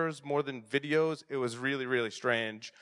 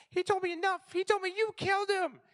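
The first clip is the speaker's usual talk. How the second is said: very high little-boy voice acting out Star Wars; imitated three-year-old son; faster than usual. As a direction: squeak it out like a toddler playing the hero. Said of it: "And he'll be like".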